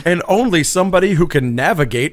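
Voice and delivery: high, loud movie-scientist warning; dramatic scientist voice. Retold or imitated. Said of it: "these scientists are gonna be like".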